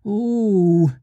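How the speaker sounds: high drawn-out "ooh"; group moan. A narrator's reading. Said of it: "they all moaned".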